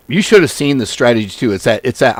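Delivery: usual speaking voice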